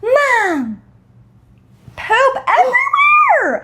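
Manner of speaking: put-on high, slow childlike cry of alarm, with a laugh; mock panicked child yelling for mom; daughter calling out. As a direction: shriek it like a little girl finding a mess. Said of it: "And she's like".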